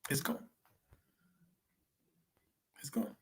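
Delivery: fading to a whisper. Real, not acted. Repeating "not a day again", fading.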